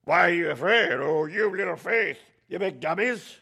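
loud, high mock preacher berating them; scolding preacher. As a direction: parody a harsh preacher chewing out the flock. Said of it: "I hate when preachers go".

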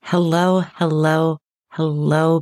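normal talking voice

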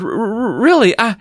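high, stuttering plea; acted flustered girl; stammering. A narrator's reading. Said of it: "Chris stammered".

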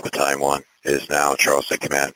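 usual speaking voice

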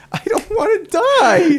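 high, laughing wail of fear; mock panic. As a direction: laugh through a fake terrified whine.

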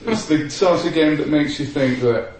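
normal talking voice

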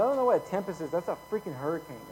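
usual speaking voice

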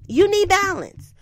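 high, loud emphatic line. Acted. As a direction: punch it up high. Emphatic advice to viewer.

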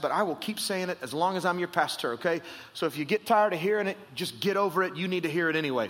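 normal talking voice

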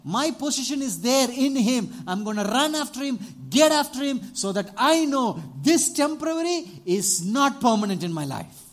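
loud, high, driving declaration; fired-up vow. Retold or imitated. Beggar resolving to chase Jesus, loud and high. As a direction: preach it at full force. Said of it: "So what he said".

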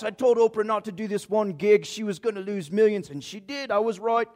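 high, chattering put-on accent; Charles Siegel. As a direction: jabber on in an exaggerated accent. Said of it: "got this incredible accent".

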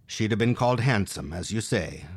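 plain reading voice

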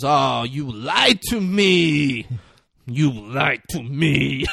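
slow, drawn-out mock accusation; imitated slow, savouring gotcha; Denzel impression. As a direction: stretch each word out in put-on reproach. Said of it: "Denzel finds one and goes".

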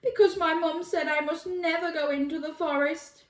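put-on high, plaintive child voice; little boy. A narrator's reading. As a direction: pitch it up as a worried small boy. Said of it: "replied the boy".

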